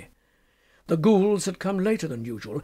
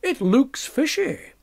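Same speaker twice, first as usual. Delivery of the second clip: wry, knowing; 8 semitones higher, slower than usual. Acted. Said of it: "he said with a nod".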